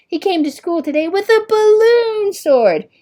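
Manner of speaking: high, loud, sneering; mock him like a playground bully; big boy jeering at Oliver in a sneering voice. A narrator's reading. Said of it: "he jeered".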